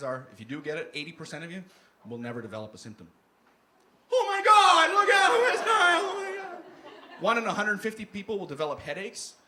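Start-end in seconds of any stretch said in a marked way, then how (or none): 4.1-6.6 loud, high hammed-up alarm; panicked mock alarm. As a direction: shriek the warning. Mocking panic over West Nile.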